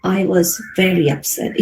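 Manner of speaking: low, subdued and upset; demonstrating a low, unhappy tone; low pitch, upset. Shown as a demonstration. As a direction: drop your pitch to sound unhappy. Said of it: "could be your lowest pitch".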